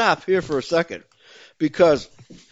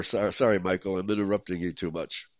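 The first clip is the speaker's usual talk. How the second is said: normal talking voice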